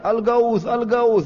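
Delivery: high-pitched mock alarm cry; crying out for help. Damdam still crying out as crowds gather, high-pitched. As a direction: wail "Al-Gaus" high, as if Mecca is under attack. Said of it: "dia terus teriak".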